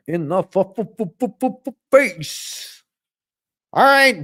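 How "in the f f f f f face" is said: stuttered build-up bursting out on "face"; mock stutter. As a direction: stutter the f over and over, then spit out "face".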